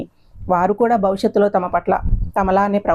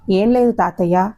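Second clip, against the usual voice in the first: plain reading voice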